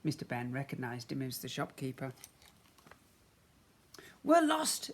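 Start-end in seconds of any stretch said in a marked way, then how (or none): 4.2-4.9 high, plaintive spaceman. A narrator's reading. Spaceman explaining to the shopkeeper, high and plaintive. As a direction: plead to the stranger, pitched up. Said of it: "explained the spaceman".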